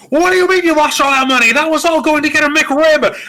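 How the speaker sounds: high, loud panicked outburst in character; Jimmy Stewart. Acted. Jimmy berating his uncle over lost money, frantic yell. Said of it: "And Jimmy's like".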